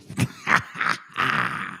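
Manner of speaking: low breathy chuckle; quiet, knowing chuckle. Real, not acted. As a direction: laugh it off in short bursts. Laughs after his told-you-so.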